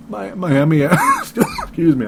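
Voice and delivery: words catch mid-choke; sputtering, throat catching on spit; choking on saliva. Real, not acted. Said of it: "I'm choking on my own saliva here".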